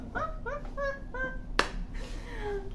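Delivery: high-pitched giggle at herself. Real, not acted. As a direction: giggle at your own silly voice. Laughing at her own voice.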